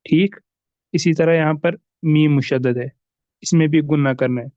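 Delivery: ordinary talk, nothing marked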